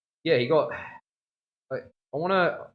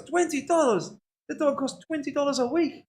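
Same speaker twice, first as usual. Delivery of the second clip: put-on much higher, shocked Dutch accent; outraged Dutch Opa; 5 dB louder than usual. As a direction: squeak out the price in a stingy Dutch grandfather's disbelief. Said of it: "He goes".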